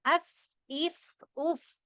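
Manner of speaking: അഫ് ഇഫ് ഉഫ് with air escaping on each ഫ്; short airy ഫ് endings; breathy fa drill. Shown as a demonstration. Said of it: "കണ്ടോ?".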